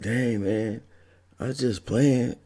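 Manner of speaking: high, hurt protest; scolded roommate backing off; defensive whine. Retold or imitated. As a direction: back down, sounding wounded. Roommate backing off after threat.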